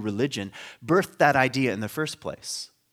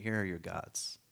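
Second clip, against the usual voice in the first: "Here are your gods." repeated much softer; softer reread; 6 semitones lower, 13 dB quieter than usual. Shown as a demonstration. Preacher rereading the verse, softly. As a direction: say it again, quietly, to yourself. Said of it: "All right".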